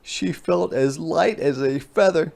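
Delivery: ordinary delivery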